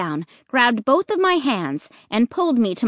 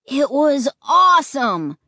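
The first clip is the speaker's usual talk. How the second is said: slow, louder and higher awesome; gleeful declaration; 4 semitones higher, 5 dB louder than usual. Acted. Luke declaring the ride awesome, gleeful. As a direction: savour every syllable. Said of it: "Luke declared".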